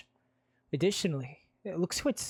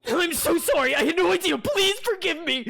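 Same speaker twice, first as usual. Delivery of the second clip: shrill, loud, frantic apology; panicked apology; 9 dB louder than usual. Acted. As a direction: beg forgiveness in panic.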